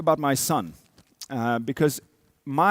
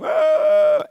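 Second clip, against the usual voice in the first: a much higher, loud mock yelp; imitated child's scream; 8 dB louder than usual. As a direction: mimic the toddler's shriek at the needle. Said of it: "So he's like".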